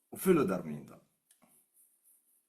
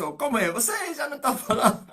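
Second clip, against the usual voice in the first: laughing quote; 10 semitones higher, 6 dB louder than usual. Retold or imitated. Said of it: "Eu disse".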